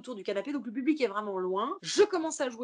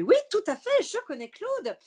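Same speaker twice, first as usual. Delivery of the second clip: sudden high, loud eager reply to the host; eager yes; 11 semitones higher, 8 dB louder than usual. Retold or imitated. Comedian answering the TV host about Claude, high and eager. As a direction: jump in brightly, far higher and louder.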